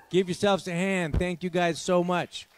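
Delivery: ordinary talk, nothing marked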